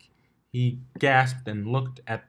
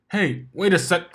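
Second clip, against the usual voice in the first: higher, louder call as she pulls away; calling after her; 9 semitones higher, 5 dB louder than usual. A narrator's reading. Blitz calls after Dash as she gallops off, loud and high.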